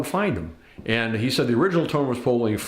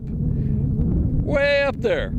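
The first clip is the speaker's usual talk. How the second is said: awed; 8 semitones higher, much slower than usual. Real, not acted. Looking up at windmill.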